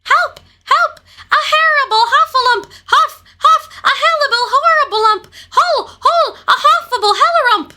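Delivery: fast, very high panicked gabble; Piglet crying out. A narrator's reading. Piglet scampers off from the heffalump, high frantic jumbled cries. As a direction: squeal in terror, tripping over the words. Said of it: "still crying out".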